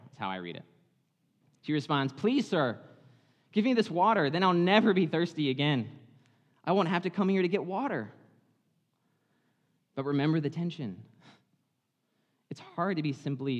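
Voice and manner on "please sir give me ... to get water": higher and louder, mock-polite sarcasm; sarcastic, eye roll. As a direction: ask for the water with an eye roll, mockingly. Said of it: "It's almost sarcastic, like she's saying it with an eye roll".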